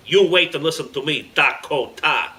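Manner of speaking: mean terrorist leader, pressing each word; loud and mean. A narrator's reading. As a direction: order him meanly. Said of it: "spoke loudly and meanly".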